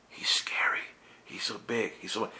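fearful whisper. Acted. Others fearful of God.

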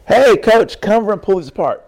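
loud, quick call across the gym; calling to the coach. Retold or imitated. Calling the coach over to the machine, loud and quick. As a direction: shout it over to the coach, fast. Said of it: "I go".

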